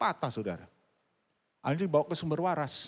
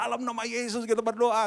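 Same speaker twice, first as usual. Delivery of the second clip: high, projected praying voice; re-enacted prayer; 9 semitones higher than usual. Pastor praying again with the mother, raised fervent voice.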